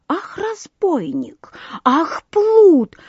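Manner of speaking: high-pitched indignant cries building in volume; indignant outcry. A narrator's reading. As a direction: exclaim as a scandalized crowd. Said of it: "возмутились все рыбы и все птицы".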